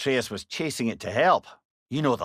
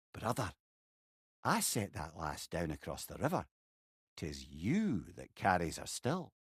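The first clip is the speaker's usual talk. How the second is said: put-on calm old monk; 9 semitones lower, 13 dB quieter than usual. Mimir voicing the elder monk's gentle answer, hushed. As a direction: drop to a quiet sage tone.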